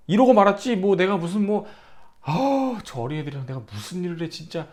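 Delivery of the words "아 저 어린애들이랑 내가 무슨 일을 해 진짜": much softer, fast grumble; mock muttered grumble. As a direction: grumble under your breath, fast. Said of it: "이런 적이 없었다고".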